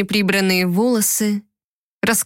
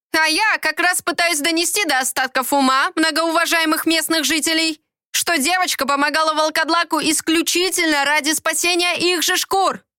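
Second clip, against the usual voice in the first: high, ringing proclamation; booming declaration; 8 semitones higher than usual. Acted. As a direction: announce it to the whole yard. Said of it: "громогласно заявила Герда".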